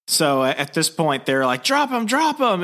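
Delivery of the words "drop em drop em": high, strained cop command; acted yell, pitched way up; mock police shout. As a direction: imitate a frantic officer giving orders. Cops ordering him to drop claws.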